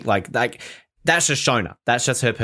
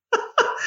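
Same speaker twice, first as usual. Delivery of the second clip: laughing. Real, not acted. Laughs at the quote.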